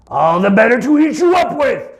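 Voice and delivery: high, loud pounce of a wolf; wolf's shout. Acted. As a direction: burst out loud as you lunge. Wolf reveals himself.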